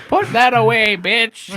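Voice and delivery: lightly voiced old woman talking tough; trash-talking grandma; feisty old woman. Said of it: "just an extremely old woman".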